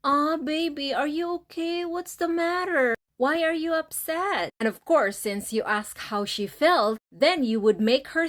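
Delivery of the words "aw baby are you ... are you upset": tender comforting. Shown as a demonstration. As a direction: comfort her like a worried lover. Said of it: "this is what you have to do".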